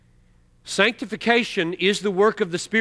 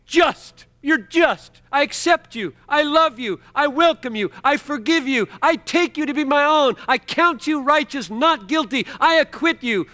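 high, loud, fervent voice of God pronouncing pardon; God's voice; 9 semitones higher, 4 dB louder than usual. Retold or imitated. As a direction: proclaim it like a judge forgiving. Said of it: "and he's just saying".

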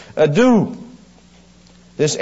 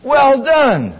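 high, glad well done; proud approving master; warm praise; 10 semitones higher than usual. Retold or imitated. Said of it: "I'm gonna hear him say".

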